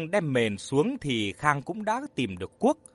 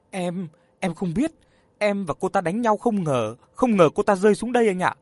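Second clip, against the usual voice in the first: stammering, rising to loud and fast; 4 semitones higher, 4 dB louder than usual. Acted. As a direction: stumble over the words in panic. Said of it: "Mỹ Phương lắp bắp nói". (lắp bắp hoảng sợ)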